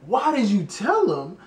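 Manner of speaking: high, loud, exaggerated why did you tell him; mock repeat, loud. Mocking his ex's question, loud high exaggerated echo. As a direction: throw her words back, hammed up.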